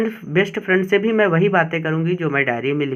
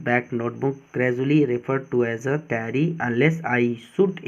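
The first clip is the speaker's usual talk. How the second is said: teacher reading the diary passage slowly; slow reading aloud; 3 semitones lower, much slower than usual. Shown as a demonstration. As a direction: read the passage slowly, like a dictation. Slow reading of English text.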